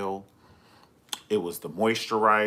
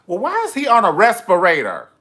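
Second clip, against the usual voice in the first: loud mock outrage; 9 semitones higher, 13 dB louder than usual. Mocking hospital scene.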